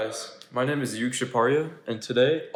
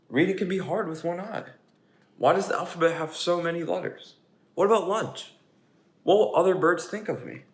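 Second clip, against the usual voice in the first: higher nervous pigeon rattling off worries; fretful pigeon; 6 semitones higher, 4 dB louder than usual. Acted. Pigeon lists its school worries to the children, higher. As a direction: list every fear.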